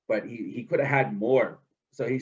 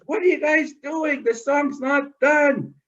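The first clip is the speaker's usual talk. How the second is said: high, loud, slowing at the end; dismayed protest; 12 semitones higher, 4 dB louder than usual. Acted. Trickster crying out as the ducks fly away, high and loud. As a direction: cry out as the meal escapes.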